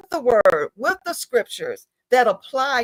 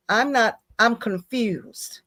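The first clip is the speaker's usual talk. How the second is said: put-on quoting little boy; slower than usual. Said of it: "he says".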